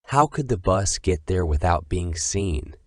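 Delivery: usual speaking voice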